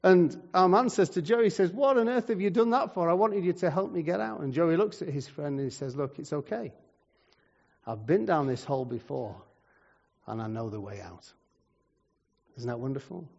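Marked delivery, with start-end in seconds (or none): 1.7-4.4 high-pitched acted protest; exasperated. Trapped man scolds his friend. 6.0-11.3 softer, lowering to a quiet close; calm reassurance. Acted. Friend reassures the trapped man.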